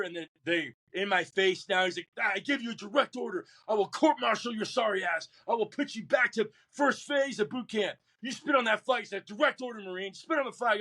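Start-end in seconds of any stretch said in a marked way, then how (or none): 2.2-9.0 drill instructor yelling. Retold or imitated. Drill instructor shouting direct order. 9.3-10.8 barked order. Retold or imitated. Drill instructor repeats direct order.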